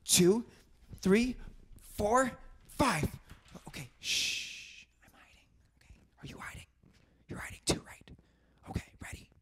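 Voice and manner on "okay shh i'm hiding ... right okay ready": hushed whisper. Acted. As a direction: whisper so nobody finds you. Whispering while hiding.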